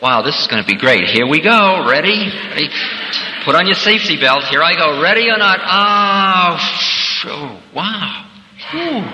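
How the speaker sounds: a giddy buckle-up, ready-or-not cheer; eager excitement. Retold or imitated. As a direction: ham up the thrill of letting go. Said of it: "you say".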